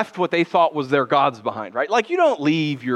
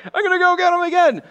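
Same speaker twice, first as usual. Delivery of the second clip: cocky charge; 14 semitones higher, 4 dB louder, faster than usual. Pretend. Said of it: "instead of saying".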